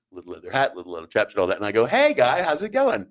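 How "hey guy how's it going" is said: loud, high hello; hammed-up high greeting; high-pitched greeting. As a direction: greet him in a raised, exaggerated pitch. Acts out greeting little man.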